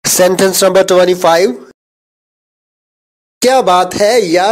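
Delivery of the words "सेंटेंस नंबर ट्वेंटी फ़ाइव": ordinary talk, nothing marked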